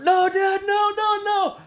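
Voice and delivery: weak, high-pitched half-hearted no; feeble protest. Retold or imitated. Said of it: "I gave it a feeble effort".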